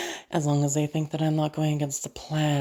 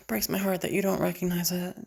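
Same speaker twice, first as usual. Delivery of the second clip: ordinary delivery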